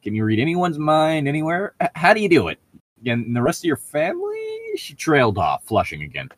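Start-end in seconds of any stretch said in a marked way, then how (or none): 0.0-4.8 put-on higher, eager questioning girl; pushing Bella's questions up high. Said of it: "I'm giving Bella way too much character with the voice".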